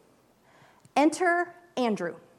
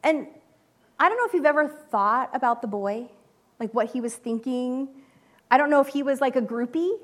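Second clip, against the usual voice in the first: ordinary delivery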